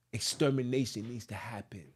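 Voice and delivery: very soft and low, fading into a whisper; hushed aside. Real, not acted. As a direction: drop to a low, near-whispered warning. Ominous aside after reading aloud.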